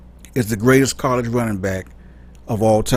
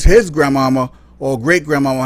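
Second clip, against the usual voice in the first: ordinary talk, nothing marked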